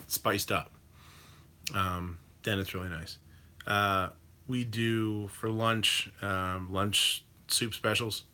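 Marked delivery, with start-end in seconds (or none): none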